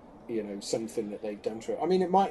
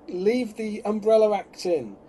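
higher, louder, drawn-out exasperated yell; retold shout; 7 semitones higher, 6 dB louder, slower than usual. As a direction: re-enact yelling at the telly, slowly. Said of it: "I actually shouted at telly".

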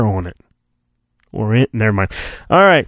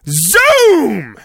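zoom effect; 8 semitones higher than usual. Acted. Speeder sound effect.

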